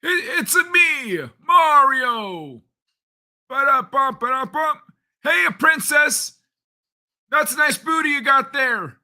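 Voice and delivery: very high cartoon falsetto plumber; Mario. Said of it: "Old Kai Mario voice?".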